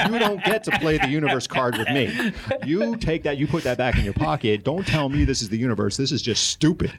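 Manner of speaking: high-pitched, exasperated scolding, not shouted; retold pissed-off rant. Man scolding his buddy over the universe remark, exasperated. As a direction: tell him off in comic outrage. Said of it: "I am just getting pissed off".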